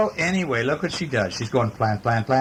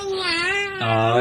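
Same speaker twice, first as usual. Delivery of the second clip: high squeaky mock surprise; storybook puppy's startled oh; surprised. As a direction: gasp it out, startled. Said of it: "And it so says".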